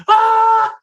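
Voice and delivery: high loud ride scream; shrill imitated scream on a ride; retold scream. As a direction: scream like you're flying out of the seat. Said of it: "he's screaming the whole time".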